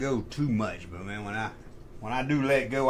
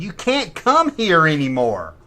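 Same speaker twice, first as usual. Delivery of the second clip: loud, drawn-out mock ban; scolding lady; 4 semitones higher, 7 dB louder than usual. Bowling alley lady banning a customer, loud imitation. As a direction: imitate her laying down the law, higher and louder.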